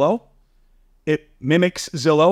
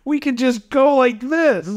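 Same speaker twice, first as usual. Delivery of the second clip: pleading pitch; 6 semitones higher than usual. Retold or imitated. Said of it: "I said back to him, I go".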